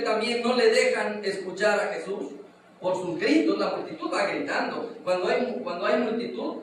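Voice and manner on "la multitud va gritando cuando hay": ordinary talk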